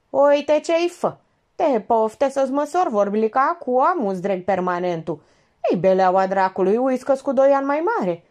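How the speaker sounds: loud, high dialect tirade; irate Moldovan Georgeta. A narrator's reading. Georgeta berates Elena over her cheek, irate. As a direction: tell her off in heated small-town Moldovan.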